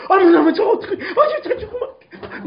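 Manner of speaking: high-pitched, hammed-up weeping; mock wailing. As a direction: imitate a viewer bawling over a TV drama. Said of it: "막 울고 불고".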